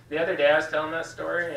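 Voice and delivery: ordinary talk, nothing marked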